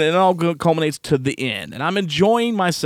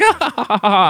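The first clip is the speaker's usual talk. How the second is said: high, mocking fake laugh; mock laughter; 6 semitones higher than usual. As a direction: imitate them laughing, pitched way up. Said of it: "they're like".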